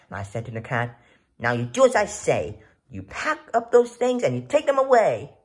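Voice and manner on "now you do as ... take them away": firm order. Acted. Boy ordering the cat.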